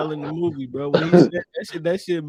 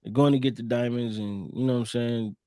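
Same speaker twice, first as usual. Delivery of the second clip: ordinary talk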